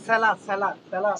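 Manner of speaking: সেলা said "se-la", ending in an open a; pronunciation model. Shown as a demonstration. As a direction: model সেলা firmly, repeated, with "la" not "le". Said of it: "এটা কি সেলা নাকি সিলে". (সেলা-র স্পষ্ট উচ্চারণ, শেষে "লা")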